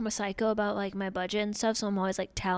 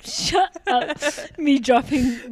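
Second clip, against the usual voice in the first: mock outrage; 4 semitones higher, 7 dB louder, much slower than usual.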